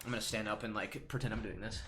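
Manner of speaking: normal talking voice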